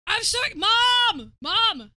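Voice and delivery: loud, high shout for mom; calling mom. Real, not acted. Streamer calling his mom over, loud and high. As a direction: yell for mom, thrilled.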